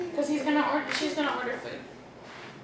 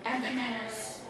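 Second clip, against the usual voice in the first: quiet lizard voice; 8 dB quieter than usual. Acted. Lizard orders fruit.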